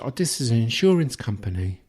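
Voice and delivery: high, hammed-up condescending sales voice; mock high-pitched insurance seller; English saleswoman imitation. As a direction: play a patronising English phone saleswoman. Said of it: "she said".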